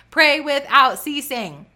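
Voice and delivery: loud, high, slow proclamation; emphatic preaching. Real, not acted. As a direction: preach it out with force. Preacher emphatic repeat of refrain.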